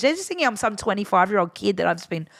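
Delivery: usual speaking voice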